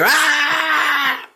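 high shouted aah; a sudden high-pitched yell; mock scream. [name] snapping, going Raj.

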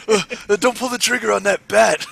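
high, loud mock pleading; Triple H impression. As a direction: plead in comic panic. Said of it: "you do the best, uh, Triple H".

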